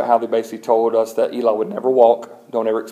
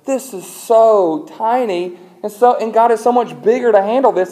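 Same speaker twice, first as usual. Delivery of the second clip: marveling; 10 semitones higher, 4 dB louder than usual. Retold or imitated. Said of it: "I'm going".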